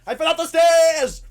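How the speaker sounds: high, very loud yell; hammed-up exasperated scream; frustrated shout. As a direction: scream it to make her understand. Boy repeats it, frustrated, shouting.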